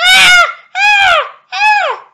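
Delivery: loud, high burning shrieks; witch screaming. Acted. Witch burns in the oven.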